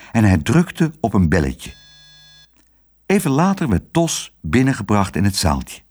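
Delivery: ordinary delivery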